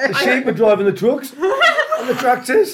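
high, loud laughing through the words; cracking up while telling it. Real, not acted. Footballer laughing at the interviewer's sheep joke, talking through laughter.

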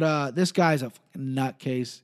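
ordinary delivery

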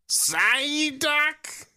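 high, drawn-out cartoon creature cry; long, piercing creature call; Psyduck impression. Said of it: "try and get into character".

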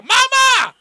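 loud, high-pitched holler; impersonated yell. Acted. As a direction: yell it out like a comic cameo. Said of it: "Anthony Anderson could have came in and been like".

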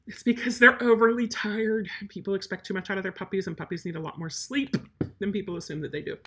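usual speaking voice

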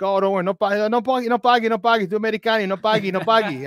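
high, eager imitation of Italian waiters insisting he not pay; imitating Italian waiters. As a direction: playfully wave off the bill like a friendly Italian waiter, pitched high. Italian waiters refusing payment.

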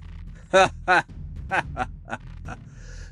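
slow, forced villain laugh; fake laugh. A narrator's reading. Victoria sees the mayor, fake laughing. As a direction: fake a drawn-out cackle. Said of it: "laughed a low, fake laugh".

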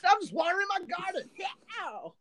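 high, hammed-up singer voice; mock rock-star voice. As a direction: play the rocker doing chores. Continuing the rock-star bit.

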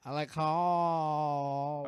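"I like cops" slow and drawn out, the lips kept apart; lips not touching. Shown as a demonstration. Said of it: "try to say, "I like cops" without your lips touching".

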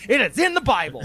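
loud, high insistence; emphatic. Real, not acted. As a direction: hammer the point home.

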